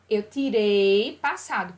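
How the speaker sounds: usual speaking voice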